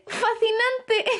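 delighted giggly gush; laughing with delight. Acted. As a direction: laugh as you marvel at it. Delighted girl laughing at new family.

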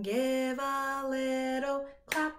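ordinary talk, nothing marked

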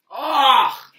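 loud revolted cry; disgusted shout. Real, not acted. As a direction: yell at the awful taste. Reacting to bad taste.

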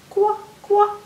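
high-pitched duckling quack; tiny duck, very high; little baby duck. Acted. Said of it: "kinda like a little baby duck".

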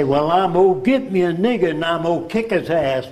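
put-on sketching a crude Southern American accent. Old man showing how a Southerner talks, Southern accent. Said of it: "you speak with a Southern accent".

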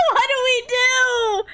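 high, drawn-out giddy squeal; flustered squeal. Real, not acted. Player flustered over getting to pick nicknames, giddy squeal. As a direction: squeal it slowly, high and overwhelmed.

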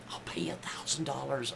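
breathy whispered bribe, barely voiced; whispered plea. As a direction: whisper it like a secret offer. Desperate patient begging nurse.

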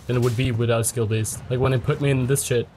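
ordinary delivery

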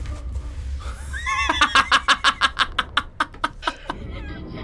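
甲高く長く笑い崩れる; こらえきれず高い声で笑う; 高い笑い声. Real, not acted. ゲームに笑い転げる. (甲高い笑い声)

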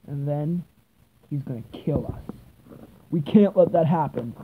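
normal talking voice

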